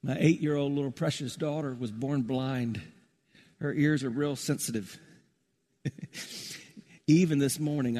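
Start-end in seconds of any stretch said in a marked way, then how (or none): none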